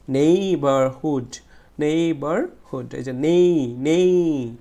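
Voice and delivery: "Neighbourhood" slow, with a long, loud নেই; stress on নেই. Shown as a demonstration. Teacher isolating the stressed syllable, slow stress on নেই. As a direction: draw out and punch the নেই of "neighbourhood". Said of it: "stretch word".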